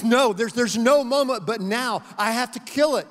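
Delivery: the angel tells the ghost the moment is now; firm angel. Acted. Angel insists, raising voice.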